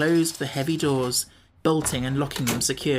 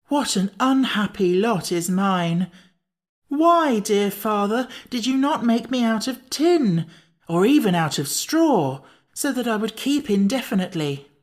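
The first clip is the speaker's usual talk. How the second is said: high, wailing, with a cry on Why; a pumpkin man lamenting he will spoil; mournful lament; 7 semitones higher than usual. A narrator's reading. Jack complaining to Tip, his father, in a high wail.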